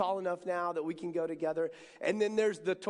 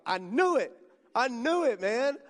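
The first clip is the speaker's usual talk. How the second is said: louder, excited I knew it; triumphant; 4 semitones higher, 7 dB louder than usual. Retold or imitated. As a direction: punch it out like a win. Pastor's triumphant reaction.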